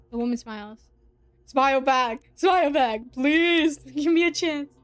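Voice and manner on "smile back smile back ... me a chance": loud, giddy begging; excited loud outburst; excited pleading. Pretend. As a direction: cheer and plead with the character. Reader urges character to smile back.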